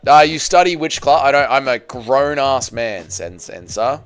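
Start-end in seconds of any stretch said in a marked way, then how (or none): none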